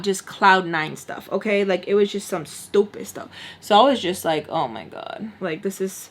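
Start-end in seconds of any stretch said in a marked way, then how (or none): 4.5-6.1 low, soft exasperation; quiet, sinking disbelief; fed-up muttering. Retold or imitated. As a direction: mutter it to yourself, drained. Fed up, retold aside to self.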